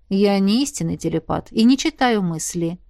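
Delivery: ordinary delivery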